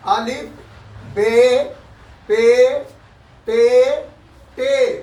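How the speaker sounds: "अलिफ बे पे ते" recited slowly, one letter at a time; slow recitation. Shown as a demonstration. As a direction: drill the letters slowly for students. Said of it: "पढ़ लीजिए एक बार". (धीरे-धीरे अक्षर दोहराते हुए)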